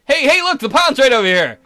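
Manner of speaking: high, loud call for attention; excited calling out. Acted. Boy changes the subject to the pond, excited calling out. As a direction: point it out loudly and eagerly.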